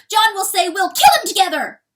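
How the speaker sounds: high, loud bellowed lead-up. A narrator's reading. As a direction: bellow it, holding the pitch high. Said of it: "bellowed the weasel".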